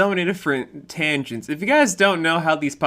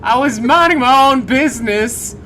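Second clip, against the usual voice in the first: high, loud pretend protest; hammed-up denial; mock defensive voice; 9 semitones higher, 6 dB louder than usual. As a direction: protest innocence in a silly voice. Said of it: "it's like".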